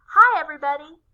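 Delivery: bold, loud greeting; brave voice. A narrator's reading. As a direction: call out bravely. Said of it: "In a brave voice".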